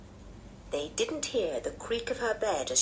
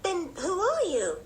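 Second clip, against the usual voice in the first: high, slow puzzled question; surprised little girl; 12 semitones higher than usual. A narrator's reading. Anne to her strange new cousin, high puzzled surprise. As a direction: ask slowly, pitched high with surprise. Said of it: "said Anne in surprise".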